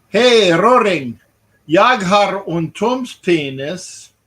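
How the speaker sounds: high, loud, slow mock Swedish accent; John Candy Swedish line. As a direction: drawl it out in hammed-up Swedish. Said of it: "what does John Candy really say in Swedish".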